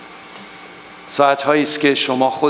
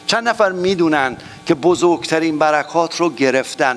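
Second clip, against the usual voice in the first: ordinary talk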